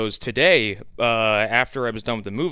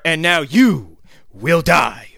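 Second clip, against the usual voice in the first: villain menace; 3 semitones higher, 7 dB louder, slower than usual. Pretend. Villain line in intro.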